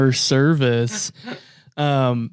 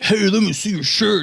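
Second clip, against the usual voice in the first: mock pushy stranger; 8 semitones higher than usual.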